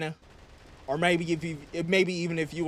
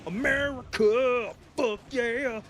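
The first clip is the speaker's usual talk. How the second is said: mock patriotic cheer; 7 semitones higher, slower than usual. Patriotic outburst in persona.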